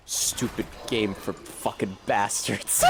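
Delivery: low, slow, soft and miserable mutter; miserable Limmy. Retold or imitated. Streamer imitating Limmy raging at Sekiro, low and slow. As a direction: sound utterly fed up with the game. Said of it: "And he's fucking miserable".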